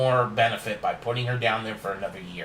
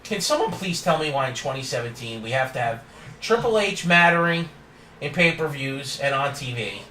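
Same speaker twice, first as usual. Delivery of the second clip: ordinary delivery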